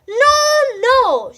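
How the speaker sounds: high, loud shouted no; the animals shout it down, high and loud. A narrator's reading. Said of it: "the others shouted".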